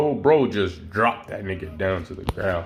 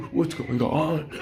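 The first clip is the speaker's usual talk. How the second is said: gasping, higher, slow mock whimper; mock crying; 6 semitones higher, slower than usual. Storyteller as Nomu crying on the ground, mock tearful gasping. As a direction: gasp and whimper it in pretend tears. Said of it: "Nomu on the ground crying".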